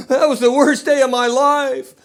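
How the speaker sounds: high, slow, drawn-out wail; mock crying. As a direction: imitate a woman wailing through tears. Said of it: "and she was crying".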